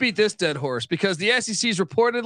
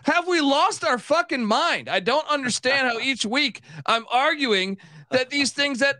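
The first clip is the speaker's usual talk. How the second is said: high, strained, slowed outrage; co-host sees a vein pulse, ranting high; exasperated rant; 5 semitones higher than usual. Real, not acted. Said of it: "I see a blood vessel".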